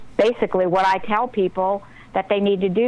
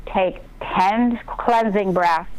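slow breathing cue; much slower than usual. Shown as a demonstration. Coaching herself to breathe slowly.

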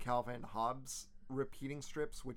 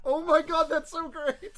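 much higher and louder, thrilled; delighted squeal; 11 dB louder than usual. Real, not acted. Delighted reaction.

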